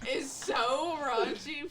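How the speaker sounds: laughing, slow and amused. Real, not acted. Telling her co-hosts about the raunchy episode, laughing. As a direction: laugh through the words.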